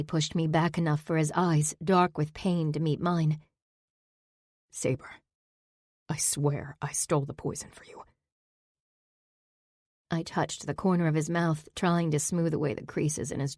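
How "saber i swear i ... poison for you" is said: quiet confession. Acted. Zack's pained confession.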